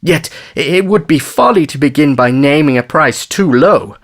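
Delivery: high, indignant haggling; outraged father. A narrator's reading. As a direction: protest as an affronted bargainer. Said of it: "the outraged father".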